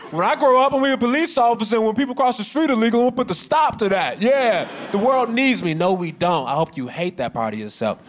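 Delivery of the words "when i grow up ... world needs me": high, earnest mock-kid voice; childhood dreamer. Cop as a child dreaming of police work, high and earnest. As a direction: play a starry-eyed child declaring a mission. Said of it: "This can't be your childhood dream".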